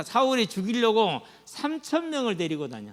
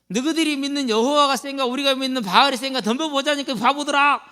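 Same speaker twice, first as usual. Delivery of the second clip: loud, higher jeering challenge; mocking taunt; 7 semitones higher, 5 dB louder than usual. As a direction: goad the enemy army. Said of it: "이렇게 놀리고 있다".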